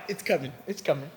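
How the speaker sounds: hushed smug certainty; smug inner confidence. Retold or imitated. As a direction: whisper it to yourself like it's already yours. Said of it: "I'm like".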